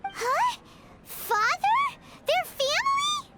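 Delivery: high, rising, stunned questions; shocked disbelief. Acted. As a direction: gasp it out in disbelief. Shocked to learn of family tie.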